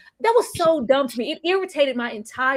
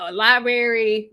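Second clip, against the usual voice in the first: usual speaking voice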